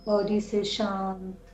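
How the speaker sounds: slow, drawn-out soothing meditation voice; guided meditation. Acted. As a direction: guide them gently into calm, stretching the words, long pauses. (धीमी, शांत ध्यान कराने वाली आवाज़)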